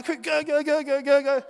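high, urgent retold cries of go; frantic urging. Surfer reliving paddling over the set, urgent.

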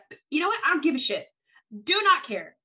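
ordinary talk

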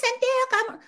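very high mock-exasperated squeak; squeaky put-on voice. Comic put-on outburst.

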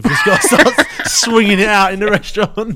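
loud laughing burst; laughing and loud as the idea grows. Real, not acted. Pitching a daft idea to his co-host, laughing loud. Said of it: "laughing shout mid-idea".

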